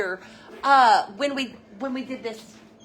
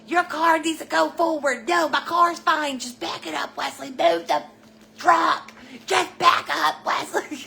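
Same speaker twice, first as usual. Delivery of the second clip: mock quarrel; 5 semitones higher than usual. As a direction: play both brothers snapping at each other in the street. Boys arguing over parking, retold.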